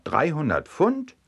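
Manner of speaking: "Dreihundert Pfund" high, rising sharply on "Pfund"; astonished echo ending on a high question; incredulous question. Acted. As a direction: echo it back in disbelief. Farmer echoes the weight in disbelief.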